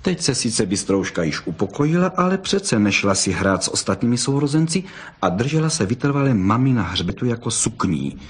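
plain reading voice

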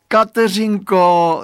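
high, loud, drawn-out joyful call; jubilant. A narrator's reading. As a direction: call her name out in delight. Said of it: "zajásal Radovan". (radostné zajásání)

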